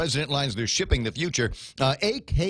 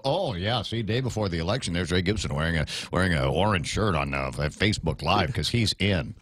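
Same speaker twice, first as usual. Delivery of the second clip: Snarky Guy; 7 semitones lower than usual. Acted. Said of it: "It's Snarky Guy".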